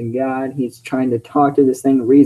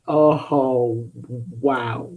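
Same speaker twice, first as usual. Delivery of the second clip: ordinary delivery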